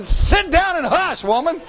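high, slow, laughing hush woman; a joking husband shushing his wife; mock scolding husband. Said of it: "I'm going".

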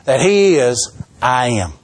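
ordinary talk

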